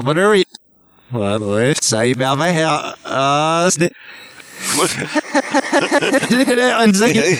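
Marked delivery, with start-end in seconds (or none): 4.6-6.8 long burst of real laughter; laughing. Real, not acted. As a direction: crack up for a couple of seconds. Laughing amid playful banter.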